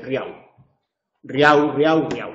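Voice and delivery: RIAU as one syllable ending in a w glide; vocalized L. Shown as a demonstration. Teacher mimics football chant to class, vocalized-L riau. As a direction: say "riau" for real, the L turned into u. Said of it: "si además vocalizamos la L".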